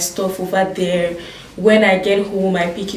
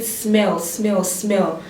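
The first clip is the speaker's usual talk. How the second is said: quoting driver; 3 dB quieter, much slower than usual. Retold or imitated. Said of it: "He kept on saying".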